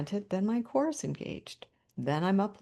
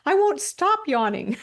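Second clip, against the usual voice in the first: laughing plea; 9 semitones higher, 7 dB louder than usual. Retold or imitated. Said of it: "one of the people says".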